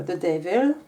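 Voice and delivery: "The Devil" said in an English accent, a bit unsure. Shown as a demonstration. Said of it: "Je sais pas si mon accent est très bon".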